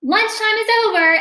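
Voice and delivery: loud, fast teacher call. Acted. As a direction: call the class back in. Teacher calls the class.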